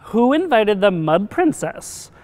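put-on offended royal girl; disdainful princess. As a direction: ask it as a snobbish little princess. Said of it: "asked a princess dressed in mauve".

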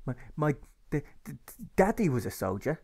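a boy stuttering out his words, lightly voiced; stammering boy. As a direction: stumble over the d's like a nervous child. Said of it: "he says".

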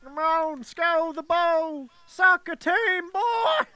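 high, loud, drawn-out cheer; excited cheering. Real, not acted. Hyping up his friend about to score, excited cheering. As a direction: cheer your friend on as he shoots. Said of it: "You get so excited".

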